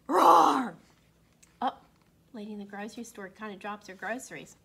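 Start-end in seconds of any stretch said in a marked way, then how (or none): 0.1-0.8 loud playful roar; dinosaur roar. Pretend. Dinosaur roars at people.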